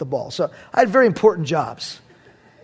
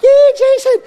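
very high, louder cheer of his name; wife cheering; 10 dB louder than usual. Retold or imitated. Wife cheering her husband from the sidelines, very high and loud. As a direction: squeal it out loud like a proud wife. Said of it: "I can't get that high tonight".

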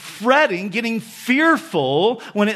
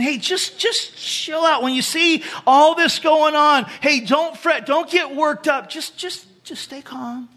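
mock casual urging; 6 semitones higher than usual. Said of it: "He's saying".

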